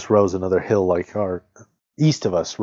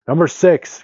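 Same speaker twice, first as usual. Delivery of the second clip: high, loud announcer's cry; 9 semitones higher, 7 dB louder, slower than usual. A narrator's reading. Man on television calling out a number, loud high cry. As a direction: call it out loud from a television set. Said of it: "cried the man on television".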